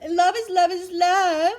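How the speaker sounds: high, loud mock chant; hammed-up, high and loud mimicry; mocking imitation. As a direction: parrot the slogan in a mocking high voice. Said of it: "You wanna say".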